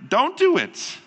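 very high-pitched, falling at the end; emphatic simple advice. Retold or imitated. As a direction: give the obvious answer in a high voice. Pastor voices the opposite answer.

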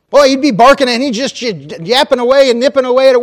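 loud, high, rushing dog frenzy; breathless excitement over the yapping dog; excited. Acted. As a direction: paint the dog going wild, fast and loud. Preacher acts out frenzied dog.

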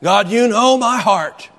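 loud, high, drawn-out appeal to God; mock tearful plea. Worshipper weeping at the altar, mock heartfelt pleading. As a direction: ham up an altar-call plea without real tears. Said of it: "we put snot all over the altar".

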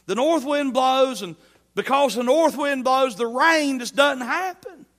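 loud, high, driving sermon cry; preaching at full force. Real, not acted. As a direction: hammer it home from the pulpit.

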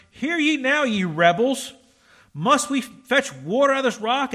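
loud, high angry rebuke; angry Moses. A narrator's reading. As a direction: hurl it at the rebels. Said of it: "Moses gets angry".

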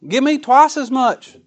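high, loud, slow begging for the double share; whining Esau. Retold or imitated. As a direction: wheedle like a greedy child on please. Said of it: "This fool Esau, he wanted the double".

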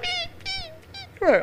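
very high, soft bird peeps; bird chirp. Retold or imitated. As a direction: chirp like a dying little bird. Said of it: "That bird like".

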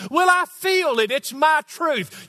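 mocking quote. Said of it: "childish feelings and emotions".

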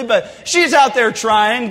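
ordinary talk, nothing marked

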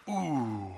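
soft low wincing ooh; a hushed, pained little ooh; quiet wince. Retold or imitated. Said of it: "it makes you go".

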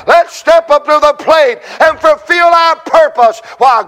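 rising, ringing preacher's call; preaching at full force. Acted. Pastor rallying the congregation, full-force preaching.

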